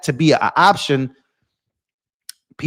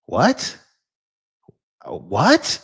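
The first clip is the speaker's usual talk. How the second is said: usual speaking voice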